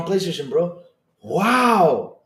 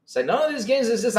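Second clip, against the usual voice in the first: usual speaking voice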